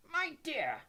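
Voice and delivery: high-pitched, scandalized professor. A narrator's reading. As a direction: protest in shocked disapproval. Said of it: "in a scandalized voice".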